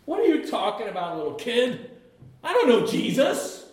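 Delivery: high, rising angry denial; defensive denial. Retold or imitated. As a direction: snap back to deny it.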